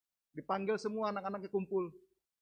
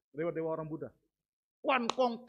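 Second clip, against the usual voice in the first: normal talking voice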